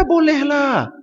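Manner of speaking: a nervous neighbour giving in; timid neighbour. Retold or imitated. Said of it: "Dia pula. Ah, kata".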